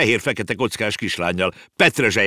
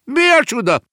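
much higher, loud yelp; shrieked exclamation; 7 dB louder than usual. A narrator's reading. Uncle crying out at the parents, loud high yelp. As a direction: fling it out as a startled cry. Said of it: "rikkantott a bácsi".

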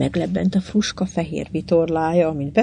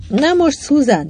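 much higher, eager cue; urging encouragement; 8 semitones higher than usual. A narrator's reading. John cueing Susan at the tiller, urging encouragingly. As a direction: spur the helm on at the decisive moment. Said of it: "bíztatta John a kormányost".

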